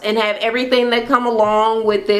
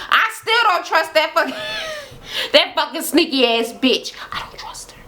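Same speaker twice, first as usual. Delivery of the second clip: imitating Amanda; 8 semitones higher than usual. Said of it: "Amanda like".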